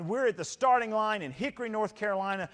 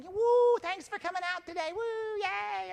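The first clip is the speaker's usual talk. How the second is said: high, bubbly cheerleader squeals; cheering Girl Scouts; 12 semitones higher than usual. Retold or imitated. Girl Scouts cheering runners on, high and squealy. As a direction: squeal it like a little girl waving a banner. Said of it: "little group of Girl Scouts waving little banners going".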